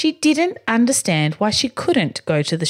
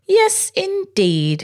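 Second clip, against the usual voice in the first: much higher, slow, lordly yes indeed; aristocratic voice; 12 semitones higher, much slower than usual. Acted. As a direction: savour it like a grand gentleman. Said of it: "aristocratic voice".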